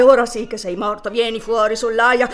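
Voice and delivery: loud gloating command; triumphant threat. Acted. Soldier gloating over the planted pistol, loud. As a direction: call him out in triumph.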